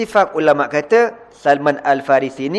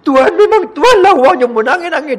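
much higher, louder crying voice; mock wailing; 13 semitones higher, 6 dB louder than usual. Salman hugging the Prophet, hammed-up wailing. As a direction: wail it out like sobbing. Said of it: "dia peluk Nabi menangis".

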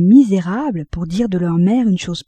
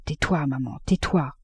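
soft, low murmured plea; murmuring; 4 semitones lower, 6 dB quieter than usual. A narrator's reading. Son on his knees begs his mother to stop, murmured. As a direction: hush her in a low murmur, a little lower in pitch. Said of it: "en murmurant".

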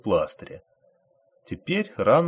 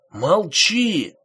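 higher, sharp shouted order; 8 semitones higher, 4 dB louder than usual. A narrator's reading. Thief shouting at the screaming woman to shut up. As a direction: snap the order at her. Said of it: "крикнул вор".

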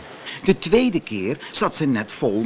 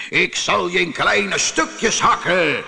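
raised, threatening genie voice; shouting genie; 5 semitones higher, 3 dB louder than usual. Acted. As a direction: threaten the little fisherman at a shout. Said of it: "schreeuwde de geest". (schreeuwende geest)

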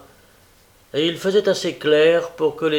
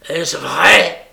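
louder, explosive question, half mad with anger; angry cry; 2 semitones higher, 9 dB louder than usual. A narrator's reading. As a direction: demand it in a burst of anger. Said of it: "presque fou de colère".

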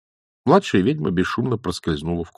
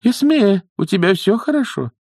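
high, raised character voice; concerned old nanny; 13 semitones higher, 3 dB louder than usual. Acted. Nanny checks on her witch friend Esme, concerned.

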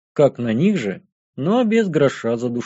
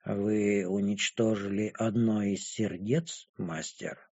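very soft, slow, low question; impassive dragon girl, faint surprise; 6 semitones lower, 12 dB quieter, slower than usual. A narrator's reading. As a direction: ask the master quietly, barely letting surprise through.

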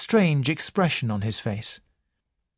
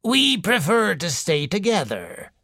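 put-on wheezing old lady; 6 semitones higher than usual. A narrator's reading. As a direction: wheeze it out like a sickly matriarch. Mrs Boynton's voice.

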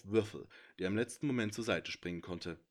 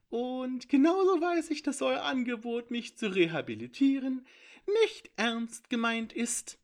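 high, loud, gleeful creature voice; a smug little thief lecturing from the shadows; high sly intruder voice; 13 semitones higher, 9 dB louder than usual. A narrator's reading. Sneaky intruder boasting to the heroes, high and gleeful.

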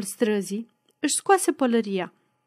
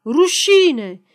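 loud, raised protest shout; howled jeer; 6 semitones higher, 6 dB louder than usual. A narrator's reading. Peasants demonstrating against the candidate, howled jeer. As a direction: cry it out at a rally. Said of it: "să manifeste urlând".

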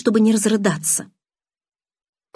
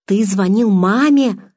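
slow, louder, horrified question; horrified cry; 3 semitones higher, 5 dB louder, slower than usual. A narrator's reading. Bella learns Charlie phoned her mother, horrified cry. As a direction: stretch it out loudly in horror. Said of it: "в ужасе закричала я".

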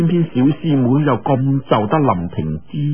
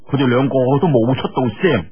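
plain reading voice

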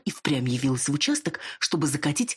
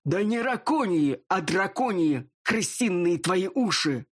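slow, raised barked rebuke; barking; 3 semitones higher, slower than usual. Acted. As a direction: snap it out at her, slow and sharp. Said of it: "рявкнул". (рявкая)

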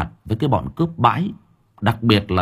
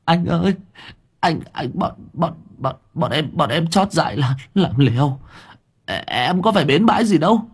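high, tearful stammering plea; acted blubbering of a scared thug; whimpering; 9 semitones higher than usual. A narrator's reading. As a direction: beg for mercy in a whiny high voice. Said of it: "bắt đầu mếu máo".

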